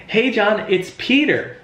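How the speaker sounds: high, bright-pitched greeting with a falling end on Peter; familiar friendly greeting. Shown as a demonstration. Sales trainer demonstrating a familiar phone intro, high and upbeat. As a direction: greet him like a long-lost best friend. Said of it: "someone wants to try this with me".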